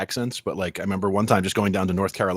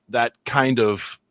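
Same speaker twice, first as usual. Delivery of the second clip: normal talking voice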